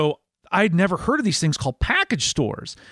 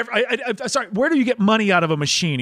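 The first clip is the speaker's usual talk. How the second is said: mock frantic correction; 6 semitones higher than usual. Frantic correction of gas station clerk.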